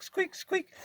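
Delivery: high squeaky mimicked squeak; squeak imitation. Imitating his truck's squeak for viewers.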